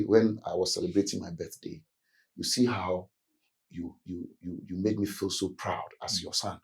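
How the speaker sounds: hushed, low words of love to a father; a son softly telling father he's cherished; soft praise. Retold or imitated. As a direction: ease the criticism with quiet warmth. Child continues soft feedback.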